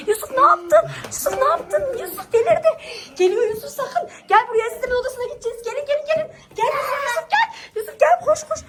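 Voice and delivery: high, laughing panic call; panicked laughing. Pretend. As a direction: call him back, laughing in alarm. Panic as son provokes zombie.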